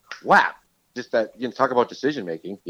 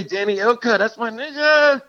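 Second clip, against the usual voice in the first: high, drawn-out hype for a friend; thrilled exclamation; 13 semitones higher, 4 dB louder, slower than usual. Real, not acted. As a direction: whoop it out with delight, stretching the last word. Thrilled at hearing friend's name.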